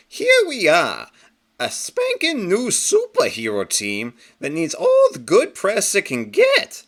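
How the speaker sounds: high, loud, slowed for emphasis; scolding cartoon hero. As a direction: lecture him like an indignant rabbit. Captain Carrot lectures Pig Iron.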